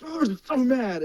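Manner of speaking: high-pitched mock fuming; imitated mad outburst. Imitating dad fuming out of the officer's hearing, mock mad. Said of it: "So he would be like".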